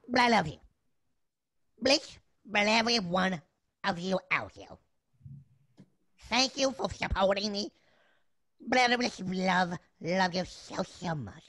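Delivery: collect yourself and sincerely thank everyone; emotional little chef. Acted. Little chef thanking supporters, emotional and halting. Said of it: "I'm getting emotional too".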